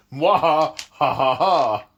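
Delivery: hammy evil laugh "mwah ha ha"; mustache twirling villain. Acted. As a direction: cackle like a cartoon villain. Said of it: "I'm just being spiteful".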